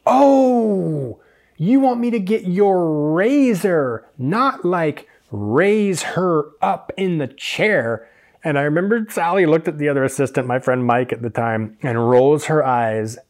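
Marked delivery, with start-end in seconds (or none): 0.0-8.1 long, high, wailing "oh" of understanding; mock realisation. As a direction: drag it out as the penny drops, high and slow. Acts out his belated realisation.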